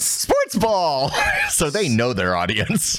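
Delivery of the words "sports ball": mock sports hype. Mock excitement about sports ad.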